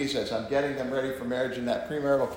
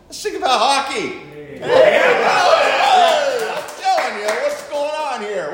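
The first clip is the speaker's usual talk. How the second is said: excited; 11 semitones higher, 10 dB louder, slower than usual. Real, not acted. Excited switch to hockey.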